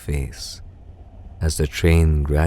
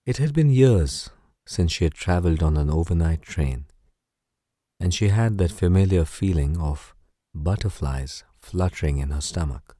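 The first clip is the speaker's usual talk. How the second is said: usual speaking voice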